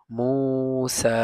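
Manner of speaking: মুসা said slowly, the মু and সা held long; madd lengthening. Shown as a demonstration. Teacher repeats মুসা for students, slow and long. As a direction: draw out মুসা slowly, holding each long vowel. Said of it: "পেশের বাম পাশে জজমওয়ালা".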